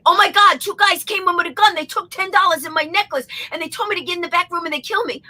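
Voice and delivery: dramatic retelling. Shown as a demonstration. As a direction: tell it like a panicked victim overselling it. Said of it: "I could tell this story a totally different way".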